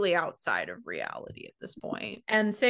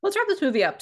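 playful echo; 12 semitones higher, 6 dB louder, much faster than usual. Acted. Host playfully echoing her co-host's wrap-up, high and teasing.

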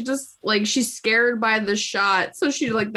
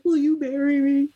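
mock proposal; 7 semitones higher than usual. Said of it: "he's like".

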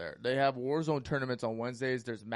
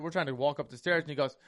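ordinary delivery

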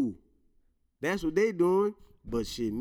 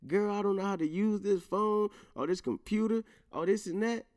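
put-on higher, fussy old-man voice; dad asking daughter about phone, higher mock whine; imitating himself as old head; 8 semitones higher than usual. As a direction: play the clueless old guy pleading. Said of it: "Whenever I say that".

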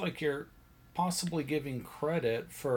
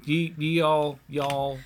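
ordinary delivery

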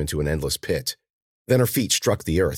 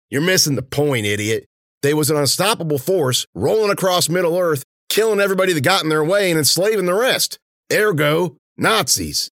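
high, loud lecturing henchman; Warner, impatient henchman; 11 semitones higher, 5 dB louder than usual. A narrator's reading. Henchman explaining his Orks theory to his partner, impatient. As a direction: argue the point forcefully at a dim partner.

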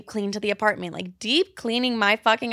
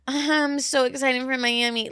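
drawn-out, higher mock excitement; faked excitement; 4 semitones higher, slower than usual. Host showing listeners the lie she nearly told, faked excitement. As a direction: put on excitement, stretching the words out slowly. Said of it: "I was gonna lie to you guys and be like".

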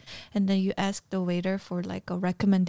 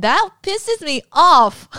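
louder, higher, slow annoyed complaint; slowed, exasperated gripe; 7 semitones higher, 9 dB louder, slower than usual. Real, not acted. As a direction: let real irritation push it up and out. Annoyed complaint about waiters.

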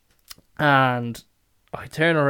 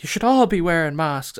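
raised, higher imitation of a scolding customer; mock scolding; 5 semitones higher, 8 dB louder than usual. Woman tells off the maskless kids, mock loud scolding. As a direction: mimic a woman telling off kids.

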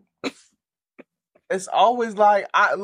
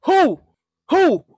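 high hooting who, getting louder; owl hoots; 9 semitones higher, 3 dB louder, slower than usual. Acted. Friend teasing, hooting like an owl. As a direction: hoot like an aggressive owl.

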